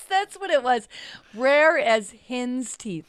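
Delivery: laughing while repeating the phrase. Real, not acted. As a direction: laugh through the words.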